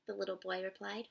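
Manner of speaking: ordinary delivery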